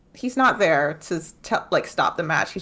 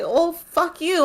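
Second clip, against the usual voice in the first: high, slow mock snap; mock angry fuck you; exasperated retort; 11 semitones higher, 4 dB louder, much slower than usual. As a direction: fire it back at the useless princess. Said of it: "And Bridget's like".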